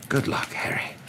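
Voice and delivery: soft, whispery farewell; murmured. A narrator's reading. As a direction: murmur a private blessing. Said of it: "he murmured".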